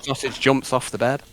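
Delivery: ordinary delivery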